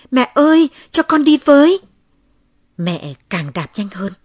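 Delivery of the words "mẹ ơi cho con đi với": put-on high, loud child's plea; loud, high-pitched begging wail; wailing cry. A narrator's reading. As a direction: cry out after a mother who is leaving. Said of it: "Tôi chạy theo mẹ gào khóc".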